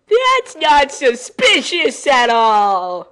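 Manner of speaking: slow, loud, heavy sarcasm; sarcastic. Real, not acted. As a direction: drag it out mockingly. Sarcastic aside on the tables.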